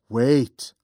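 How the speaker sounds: ordinary delivery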